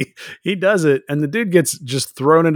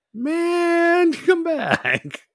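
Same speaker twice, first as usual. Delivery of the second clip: much higher, whiny call; high mock plea; 3 dB quieter, much slower than usual. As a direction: squeak out a plea to come back. Crew calling after Finnegan.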